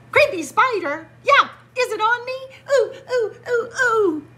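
high, loud squealing panic about a spider; mock panic. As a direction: shriek and whimper like a spooked shark.

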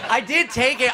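ordinary delivery

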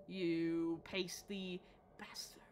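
slow, low whispered cursing; hushed, mock-menacing whisper; whispered insult. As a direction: draw the insult out under your breath. Hissed insult at game opponent.

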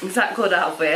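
chewing while talking; speaking between bites; mouth full of caramel. Real, not acted. Seen 3: Woman holds caramel piece, bites it at 1.1-1.6s, then chews.

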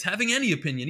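ordinary talk, nothing marked

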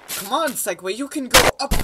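excited. Real, not acted. Urging the Segway.

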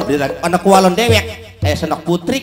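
usual speaking voice

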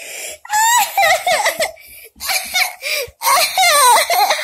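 high shrieking laugh with tears; laughing-crying. Real, not acted. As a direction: break into teary laughter.

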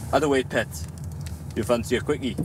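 "How the way, pet" in a Geordie accent; Geordie chat-up line. Shown as a demonstration. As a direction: give a Geordie pickup line, cheeky. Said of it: "The line that I use".

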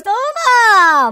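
high, loud reveal yell; triumphant shout. Pretend. Showing viewers the transformation, triumphant shout. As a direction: belt it out like a ta-da.

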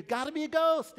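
shouted high and loud in mock panic; frightened shout. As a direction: yell it like terrified fishermen. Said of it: "they scream out".

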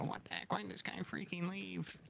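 mock whisper. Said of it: "Sam's gonna go".

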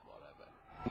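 unvoiced dismissive aside; dismissive whisper. Real, not acted. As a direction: breathe it out like you can't be bothered. Dismissing the rival's taunt.

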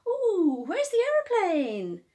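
high, loud coaxing mummy voice; sing-song distraction like a playful mum; mummy tricking. Acted. Mummy coaxing her bunny toward the bath. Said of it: "she would say things like".

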